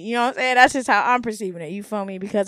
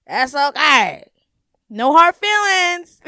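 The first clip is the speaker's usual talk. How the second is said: mock cheery brush-off; 3 semitones higher, 7 dB louder, much slower than usual. Shown as a demonstration. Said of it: "mock cheerful brush-off".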